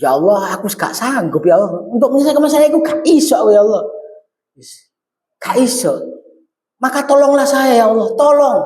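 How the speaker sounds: pleading to Allah with a touch of tears; tearful prayer. Retold or imitated. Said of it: "karo nangis-nangis sithik".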